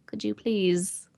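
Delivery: slow timid plea. Retold or imitated. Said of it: "she's just like".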